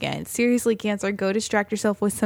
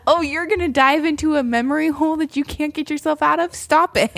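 mock blunt advice; 6 semitones higher, 3 dB louder than usual. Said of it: "She's like".

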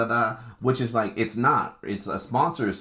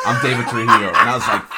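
ordinary talk, nothing marked

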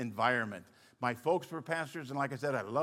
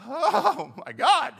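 high, loud startled gasp; shocked; 11 semitones higher, 8 dB louder, much slower than usual. Retold or imitated. Young pastor reacting loudly to a man confessing an affair. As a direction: react like you just heard a bombshell.

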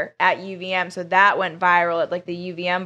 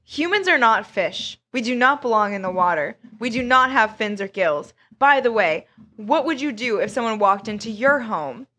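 ordinary talk, nothing marked

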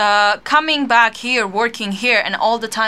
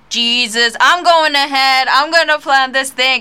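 louder, higher imitation of someone charging ahead; imitated go-getter; 5 semitones higher, 6 dB louder than usual. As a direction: play up her bold determination. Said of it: "you're like".

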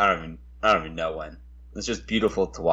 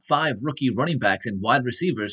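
higher, punchy announcer-style intro; pitched-up show-opener delivery; podcast intro read; 5 semitones higher than usual. Acted. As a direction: sell the episode rundown like an announcer. Reads the episode intro.

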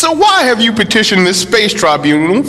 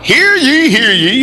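town crier; 3 semitones higher than usual. Acted. Opens the space tribunal.

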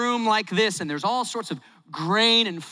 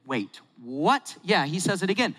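normal talking voice

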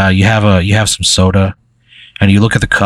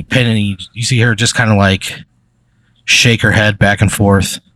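normal talking voice

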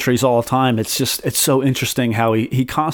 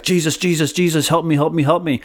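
higher, pleading mock panic; 4 semitones higher than usual. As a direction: whine for help like you're losing it, half-joking. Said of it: "is freaking out".

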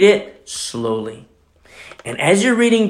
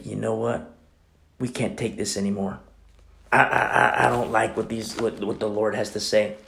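muttered complaint; 5 semitones lower, 8 dB quieter than usual. Retold or imitated. Disciples complaining, very quiet.

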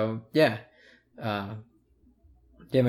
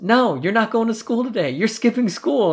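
raised, high-pitched retold insistence; insistent dad; 11 semitones higher, 5 dB louder than usual. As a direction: overrule the child's protest emphatically. Imitates dad overruling the protest.

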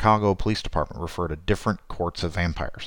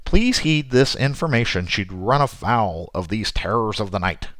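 ordinary talk, nothing marked

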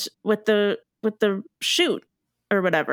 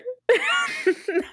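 high burst of laughter. Real, not acted. Laughs at joke.

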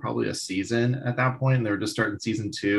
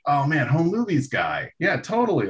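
excited recognition; 7 semitones higher than usual. Retold or imitated. Sudden recognition, retold.

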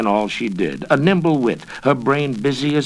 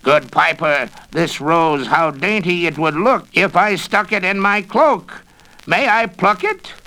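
higher, louder sly witch voice; wheedling witch; 8 semitones higher, 3 dB louder than usual. Acted. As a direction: coax sweetly to get the rose. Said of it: "Again she spoke".

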